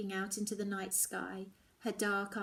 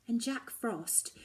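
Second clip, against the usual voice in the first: ordinary delivery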